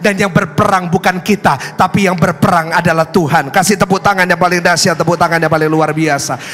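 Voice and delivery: loud, driving sermon delivery; full-force preaching. Acted. Preacher rousing the congregation, loud full-force preaching. Preacher drives home his point.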